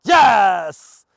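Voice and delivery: very loud, high shout of praise. Real, not acted. Trainer shouting praise to distant dog, loud. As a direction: yell it across the field.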